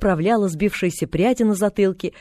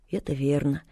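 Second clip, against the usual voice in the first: softer, gentle agreement; sympathetic; 4 semitones lower, 6 dB quieter than usual. A narrator's reading. Pollyanna agreeing with the invalid, softly sympathetic. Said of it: "с сочувствием отозвалась Полианна".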